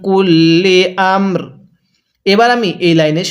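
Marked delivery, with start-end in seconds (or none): 0.1-1.4 কুল্লি আমর with light র at pause; tajweed chant; slow recitation. Shown as a demonstration. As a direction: recite slowly. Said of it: "তাহলে".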